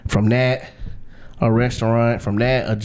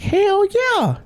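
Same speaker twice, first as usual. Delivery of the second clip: high-pitched inner cheer; thrilled, drawn-up exclamation; elated. Retold or imitated. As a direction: celebrate it privately, voice shooting way up. Acts out his delighted private reaction.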